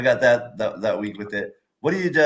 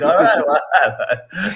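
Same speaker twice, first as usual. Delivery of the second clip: laughing; 7 semitones higher, 7 dB louder, much slower than usual. Retold or imitated. Retelling awkward reply, laughing.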